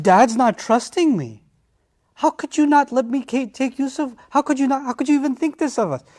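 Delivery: high, hurt pleading; wounded brothers. Acted. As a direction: act wounded and betrayed. Brothers plead hurt to their father.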